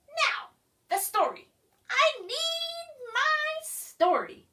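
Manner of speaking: a hint of a whiny demand; lightly demanding creature. A narrator's reading. Creature demands a bedtime story, lightly whiny. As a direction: insist on the story.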